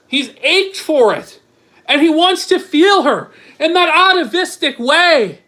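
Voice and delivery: scandalized mocking holler; mock outrage. Real, not acted. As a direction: exclaim it high and punchy in disbelief. Mocking the texts in disbelief.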